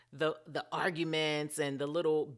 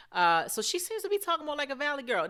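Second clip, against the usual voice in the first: light mock Valley girl, pitched much higher and quicker; 10 semitones higher, 4 dB louder, faster than usual. Vlogger mocking a cast member's new talk, light Valley girl lilt. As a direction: tease her with a hint of Valley girl. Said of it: "we know what Valley girl talk is".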